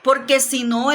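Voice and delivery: normal talking voice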